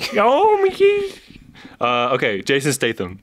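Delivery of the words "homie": "Homie" squeaked very high and bright; squeaky homie. Acted. Copying her friend's voice, a squeaky high "Homie". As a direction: echo "Homie" in a tiny high voice.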